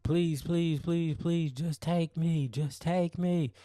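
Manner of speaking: high pleading wail, imitating her; hammed-up suicidal plea, pitched high; mock desperate screaming. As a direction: beg in a high mock-hysterical voice. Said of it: "screaming this in the hotel room".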